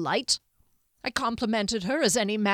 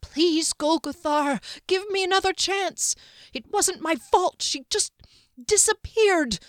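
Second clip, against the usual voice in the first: much higher, louder, pleading whine; shaking whine; 10 semitones higher, 6 dB louder than usual. A narrator's reading. Servant on his knees begging the wizard, high and whining. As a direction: beg on your knees, whining. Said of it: "the shaking whine in his voice".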